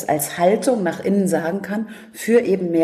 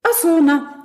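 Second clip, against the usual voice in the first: a light, casual high "Ach so"; offhand; 11 semitones higher, 4 dB louder than usual. Retold or imitated. Therapist brushes off her mix-up, casually. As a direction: brush it off like nothing happened. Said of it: "sagt ganz lapidar".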